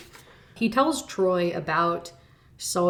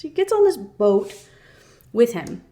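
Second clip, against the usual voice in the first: higher and louder, aghast; outraged emphasis; 5 semitones higher, 7 dB louder than usual. Real, not acted. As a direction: hit it in disbelief. Outrage at plot.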